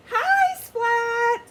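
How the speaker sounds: very high, loud sing-song chorus; class chorus. Acted. Whole class greeting the new cat, loud chorus. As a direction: greet him all together, loud and high.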